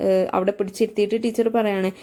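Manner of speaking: ordinary talk, nothing marked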